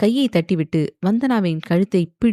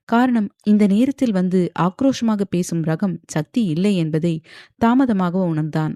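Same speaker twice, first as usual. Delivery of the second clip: ordinary delivery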